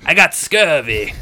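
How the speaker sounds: loud, slow mock pirate; pirate accent. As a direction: draw it out like a pirate. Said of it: "I gave him a Pirate's accent".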